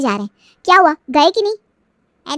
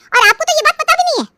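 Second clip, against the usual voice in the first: accusing; 11 semitones higher, 4 dB louder than usual. Acted. Sister-in-law accuses the wife.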